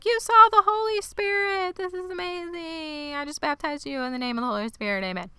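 very high, gushing churchy voice; ecstatic preacher voice. Retold or imitated. Laura Lee rejoices over baptizing Lottie, high and ecstatic. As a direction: sing it out like a rapt revivalist, way up high. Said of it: "Laura Lee pulls her up".

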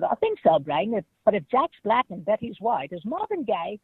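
a dim-witted cartoon mouse answering, pitched high; Pinky voice. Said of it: "And I said".

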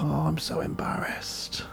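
slow whispered groan of shame; embarrassed whisper. Retold or imitated. Daughter in the car whispering her embarrassment. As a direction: whisper it like you want to vanish. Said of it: "whisper heard".

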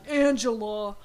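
Angela with a drawn, rounded ending; affected pronunciation. Acted. Repeats name in put-on pronunciation.

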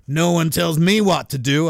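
put-on second, fuller stab at a Missouri accent. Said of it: "is that the right accent for someone in Missouri?".

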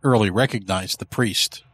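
usual speaking voice

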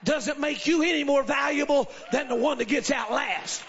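loud, high, shouted preaching. Real, not acted. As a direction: preach it at full force. Preaching at full force.

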